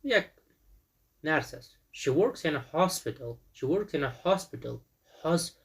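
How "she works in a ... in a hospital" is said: She works in a hospital, slow, with HOS in hospital hit hard; stress on HOS. Shown as a demonstration. Teacher showing pupils where the stress falls, slow. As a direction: punch the first syllable of hospital. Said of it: "hos یعنی فشارش اینجاست".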